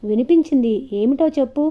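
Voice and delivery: ordinary delivery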